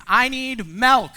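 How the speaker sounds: put-on high, loud whiny child calling milk; demanding toddler. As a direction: whine it like a small child wanting milk. Four-year-old demanding milk.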